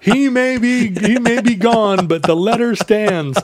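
high, slow grand proclamation; pompous senator oratory. Pretend. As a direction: proclaim it like a statesman.

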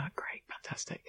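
hushed, breathless whisper; whispered praise. Retold or imitated. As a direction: whisper a quick director's aside. Director's brief aside to actor.

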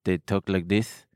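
mock Israeli accent with a lisp; put-on Israeli accent, rising at the end. Shown as a demonstration. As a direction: demonstrate how an Israeli accent sounds. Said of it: "the Israeli accent is a lot like this".

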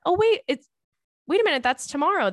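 high, loud burst of surprise; excited wait-a-minute discovery; sudden realization. Real, not acted. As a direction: catch it mid-sentence, amazed. Realizes anniversary is tomorrow.

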